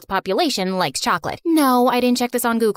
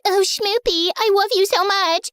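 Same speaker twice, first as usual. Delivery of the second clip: put-on higher, gushing lovesick girlfriend; mocking lovey-dovey girl; 7 semitones higher than usual. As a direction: gush it like a besotted girlfriend. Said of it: "And the girl was like".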